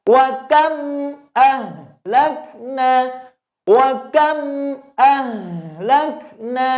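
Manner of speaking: high, slow chant with a little hum left on KAM; slight lingering mim. Shown as a demonstration. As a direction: recite WA KAM, letting the M sound briefly. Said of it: "Masih ada sisa suara".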